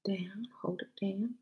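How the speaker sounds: usual speaking voice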